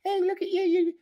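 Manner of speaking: very high, squealed mocking voice; jeering keyboard coward. Speaker mimics mealy-mouthed cowards hiding behind keyboards, high mocking voice. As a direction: mimic a sneering coward in a squeaky put-on pitch. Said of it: "cowards hiding behind the, the keyboards going".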